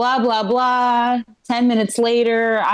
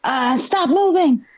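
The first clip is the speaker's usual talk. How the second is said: imitating scared cop; 7 semitones higher, slower than usual. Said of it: "he's terrified".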